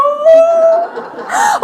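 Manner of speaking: high, loud gasping half-laugh half-sob. Retold or imitated. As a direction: gulp as the tears come. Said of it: "The tears are coming".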